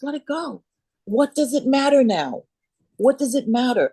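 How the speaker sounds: a gentle teacher telling her to let go; gently urging mentor. Retold or imitated. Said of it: "she goes".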